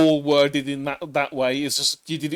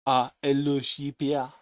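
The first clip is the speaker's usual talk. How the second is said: put-on French accent. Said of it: "now we're French".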